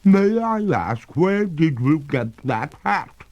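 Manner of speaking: a dripping ghost lady, amused and unhurried; water ghost. A narrator's reading. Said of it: "returned the ghost".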